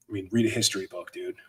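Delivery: ordinary talk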